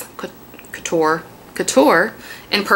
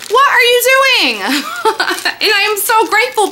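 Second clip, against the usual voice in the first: laughing amazement; 11 semitones higher than usual. Real, not acted. Overwhelmed by the gifts.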